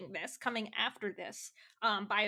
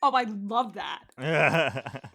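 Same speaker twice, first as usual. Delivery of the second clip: laughing delight; 4 semitones lower, 9 dB louder, much slower than usual. Real, not acted.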